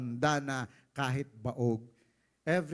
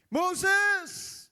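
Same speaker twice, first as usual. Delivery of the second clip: wailing cry; 8 dB louder than usual. Acted. Israelites wail to Moses.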